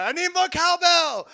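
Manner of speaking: slow, high, loud cowbell cry; Christopher Walken impression. Medic running away under fire, loud Walken. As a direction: belt it out Walken style. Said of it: "Christopher Walken came out".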